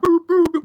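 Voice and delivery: high, loud boops; three punched high-pitched beeps; imitated truck beeps. Imitates the mosquito truck's noise.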